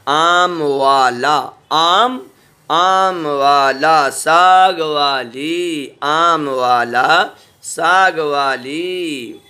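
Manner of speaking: slow, drawn-out words for the class; slow reading drill. Shown as a demonstration. Teacher slowly reading practice words to children. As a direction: read each phrase slowly for pupils to copy. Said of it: "अब ये मिला के पढ़ा".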